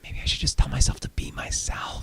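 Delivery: breathy whisper of a revelation; hushed awed whisper. Acted. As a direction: whisper it like a secret discovered.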